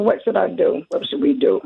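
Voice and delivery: ordinary delivery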